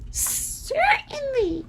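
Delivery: very high, slow squeaky mouse voice; drawn-out squeak of a tiny queen. A narrator's reading. Mouse queen answering Dorothy, very high and slow. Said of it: "answered the Queen".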